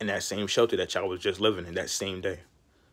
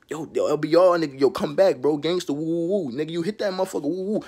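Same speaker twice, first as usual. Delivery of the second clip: lottery callers celebrating the winner, excited whooping; excited cheering; 6 semitones higher than usual. Retold or imitated. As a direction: whoop it up like a hype man. Lottery people cheer him.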